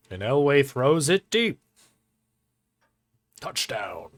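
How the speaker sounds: slow, booming play-by-play call; sports announcer. Retold or imitated. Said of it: "Kinda talks like a sports announcer".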